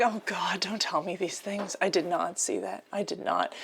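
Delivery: hushed whispered aside. Real, not acted. Spotting a tempting Mew bag, a soft whisper. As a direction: whisper it like a secret to yourself.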